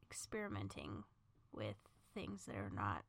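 hushed, conspiratorial whisper. Real, not acted. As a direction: whisper it like a secret. Whispers the taboo part.

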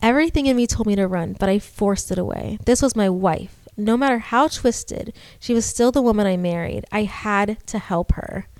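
steady storytelling read; story narration. A narrator's reading. Reads horror story aloud.

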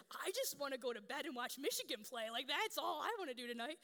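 loud, comic exasperation; mock pleading protest. Tired mother wanting to watch Michigan play, mock exasperated protest. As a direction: whine about the ruined evening.

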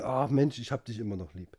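a hint of a fragile crooner; soft mock tender. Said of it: "der hat euch jetzt gesagt".

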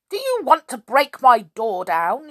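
high, loud indignant challenge; affronted old woman's outburst; cross cry. A narrator's reading. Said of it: "she cried".

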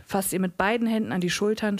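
ordinary delivery, nothing marked

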